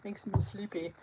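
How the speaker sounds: drowsy whispered murmur; sleepy whisper. Real, not acted. Relaxed from chamomile tea, telling viewers she's sleepy in a whisper. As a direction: let it fade into a whisper. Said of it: "I'm so relaxed with my chamomile tea".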